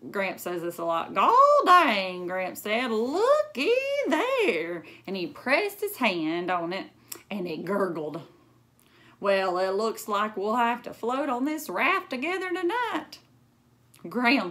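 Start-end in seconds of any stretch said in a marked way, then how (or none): 1.1-2.3 put-on loud, high old-man yelp; hammed-up country grandpa; Gramps exclaiming. Gramps exclaims at water bed. 2.9-4.8 high, slow drawn-out wonder; hammed-up country grandpa; Gramps marveling. Gramps exclaims at water bed. 9.2-13.2 lightly voiced old man, amused; a hint of a country grandpa; folksy Gramps. Gramps joking about bed.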